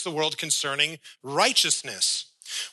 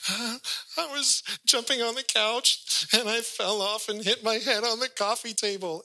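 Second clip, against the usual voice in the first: put-on high small-kid voice, sheepish; little boy voice; 9 semitones higher than usual. Son admits the fall to dad, high childlike voice. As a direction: play the guilty child owning up.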